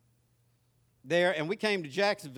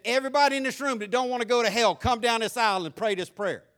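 louder, higher preacher's altar call; 6 semitones higher, 6 dB louder than usual. Retold or imitated. Boy's preacher calling sinners forward, louder. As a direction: call them down the aisle. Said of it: "when the preacher said".

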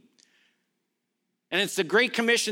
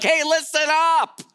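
higher-pitched, attention-grabbing call; emphatic; 6 semitones higher than usual. Acted. Preacher voicing Jesus demanding attention, emphatic. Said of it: "it's emphatic. He's like".

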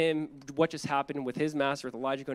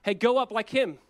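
higher, louder jeering shout-out; mocking; 6 semitones higher, 5 dB louder than usual. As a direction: taunt him as the crowd would. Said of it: "mocking the work of Elisha".

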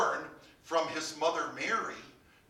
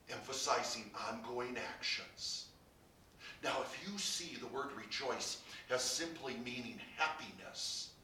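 usual speaking voice

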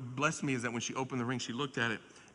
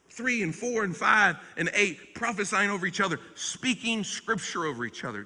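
preaching build; 7 semitones higher, 8 dB louder than usual. Acted. Preacher to the congregation, building in rising fervor. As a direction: lift it like the pulpit is heating up.

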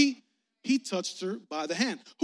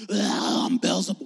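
loud, low demonic roar; hammed-up Beelzebub voice; mock demon; 4 semitones lower, 6 dB louder than usual. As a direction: roar it like a comic-book demon. Mimics a demon announcing itself.

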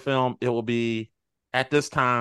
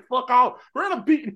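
Blades boasting; 10 semitones higher, 5 dB louder than usual. Retold or imitated. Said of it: "We say".

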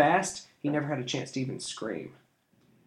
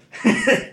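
loud, high mocking laugh; thief laugh; 11 semitones higher, 9 dB louder than usual. A narrator's reading. Thief scoffing at the King's Guard, loud laugh. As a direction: laugh at the boy's threat. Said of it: "The thief laughed".

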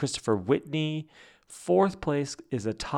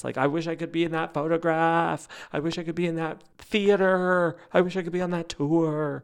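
jealous whining; 4 semitones higher than usual. Real, not acted. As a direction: whine each wish, stretching the last word. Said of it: "but jealous".

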